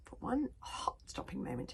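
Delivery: ordinary delivery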